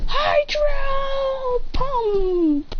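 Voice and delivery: very high, very slow stretched call; drawn-out battle cry. Acted. Kid hamming up a trainer's attack order, high and drawn out. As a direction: hold the attack name out like an epic yell. Over-the-top attack command, then kidding.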